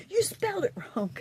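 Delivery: high, fast sing-song mockery; quick, high mock of a nitpicker. Mocking a critic's comment.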